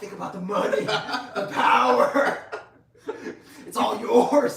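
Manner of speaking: loud, high, stretched-out temptation; hammed-up manic sales pitch with laughter; psycho schemer. Commander promising money and power, crazily eager. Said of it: "He's like a fucking psycho".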